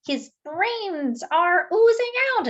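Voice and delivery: a put-on voice crying that brains ooze out; alarmed donut. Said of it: "this guy said".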